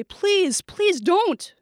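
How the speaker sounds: high, urgent begging; shouted plea. A narrator's reading. Girl in the doorway begging the shooter, shouted. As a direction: cry out, pleading. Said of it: "a voice shouted from the doorway".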